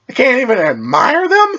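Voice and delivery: high, indignant disbelief; can't believe it, pitch it up high. A narrator's reading. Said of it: "he said in disbelief".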